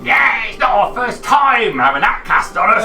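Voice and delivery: high, loud laughing shout; laughing monster shout. Acted. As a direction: bellow it through laughter.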